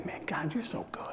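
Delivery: hushed night prayer; awed whisper. Retold or imitated. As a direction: whisper it in wonder at the stars. Retold prayer under night sky.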